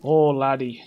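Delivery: Irish brogue, mock scolding the player; Brynjolf's Irish brogue. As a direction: scold like a put-out Irish fence. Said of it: "the origin story of his accent".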